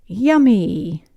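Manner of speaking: plain reading voice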